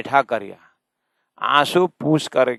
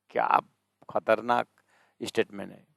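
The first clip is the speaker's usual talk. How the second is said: normal talking voice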